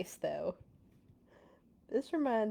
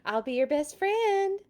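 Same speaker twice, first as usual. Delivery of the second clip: high, louder pleading offer; bargaining kid; 9 semitones higher, 6 dB louder than usual. Acted. Pigeon bribing the reader, high and pleading. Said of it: "this is like a kid".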